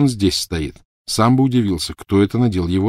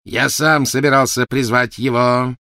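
slow, loud, raised protest; indignant; 5 semitones higher, 5 dB louder, slower than usual. Acted. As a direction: assert the claim forcefully. Quarrel over who summons the master. (возмущённо и громко)

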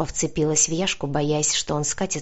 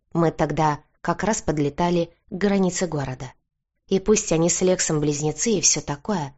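usual speaking voice

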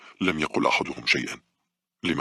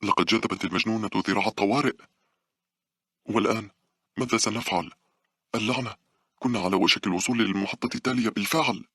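higher-pitched irritation; irritated; 8 semitones higher than usual. A narrator's reading. Monica complaining about the emergency lever, irritated. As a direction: vent annoyance in a higher voice. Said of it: "وهي تشعر بالغضب بدورها".

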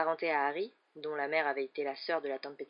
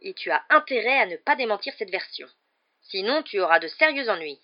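louder, higher warning; stern threat; 3 semitones higher, 8 dB louder than usual. A narrator's reading. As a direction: threaten the boy with authority. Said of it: "lança l'oncle Vernon".

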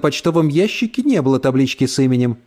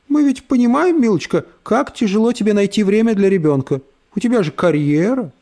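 sugary condescending lady; 7 semitones higher than usual. Acted. Брит Мари сладко поучает маму.

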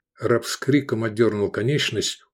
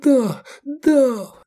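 high, languid sultry voice; languid sultry woman; 4 dB louder than usual. A narrator's reading. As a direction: breathe it languidly. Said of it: "томно сказала Овидия".

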